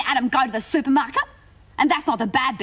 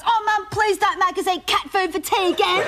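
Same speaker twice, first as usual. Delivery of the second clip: mock loud whine, higher; imitating a loud whine; 5 semitones higher than usual. Little brother loudly whining to Mum in supermarket. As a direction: ham up a little brother embarrassing his sister. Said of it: "really loud".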